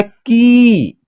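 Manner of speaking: की with a long, stretched ee; drawn-out vowel. Shown as a demonstration. Teacher showing the long ee sound, की drawn out. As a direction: hold the vowel of की, letting it fall at the end. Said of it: "खींच करके होगा".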